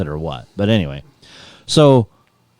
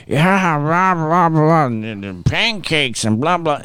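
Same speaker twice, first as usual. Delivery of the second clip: mock rambling drunk; 7 semitones higher, slower than usual. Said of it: "he was just like".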